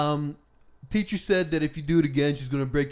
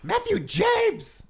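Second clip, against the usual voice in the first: mock mom yell; much slower than usual. Mom scolding full name.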